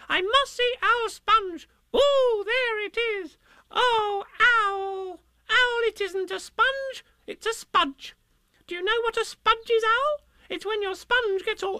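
put-on very high, breathless little-kid voice; excited squeal. A narrator's reading.

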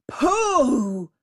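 impatient tiger cutting in; scornful tiger. A narrator's reading. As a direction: burst in with an explosive pooh. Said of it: "interrupted the tiger".

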